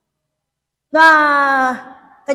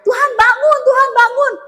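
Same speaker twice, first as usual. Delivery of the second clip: urgent wake-up plea; 8 semitones higher than usual. Acted. Disciples wake the Lord.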